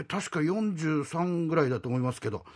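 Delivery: usual speaking voice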